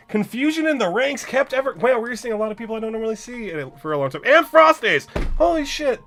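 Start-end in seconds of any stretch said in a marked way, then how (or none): 4.2-6.0 loud, high welcome call; excited greeting. Real, not acted. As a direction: shout a happy hello to a friend. Greeting a chat viewer by name.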